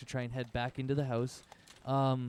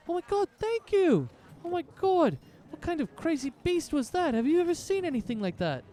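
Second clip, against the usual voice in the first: much higher, breathless thankful gush; grateful relief; 5 dB louder than usual. Acted. Old couple thanks the warlock, excited relief.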